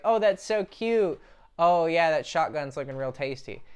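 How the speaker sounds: high, loud, slow sarcastic commenter voice; mocking the commenters. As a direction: mimic a cooing commenter with dark sarcasm. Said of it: "They're all just like".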